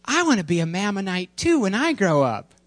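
put-on high, quick child voice; playful kid. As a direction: pipe up like an eager small child. Playful child voice after the cartoon.

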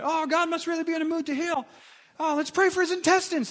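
mock wide-eyed wonder; gushing amazement. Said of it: "They go".

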